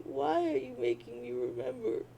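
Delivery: ordinary delivery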